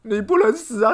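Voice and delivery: high, loud wailing over a dying friend; hammed-up grief. Quoting a comedy film hero mourning his pet cockroach, overblown wail. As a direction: wail it like a melodramatic movie lament. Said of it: "周星驰《唐伯虎点秋香》里面那一个啊".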